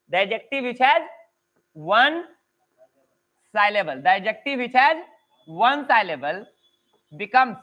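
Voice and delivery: words parcelled out with long gaps; halting, word-by-word dictation pace; slow dictation. Real, not acted. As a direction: dictate slowly, pausing for note-takers. Teacher dictates a rule slowly.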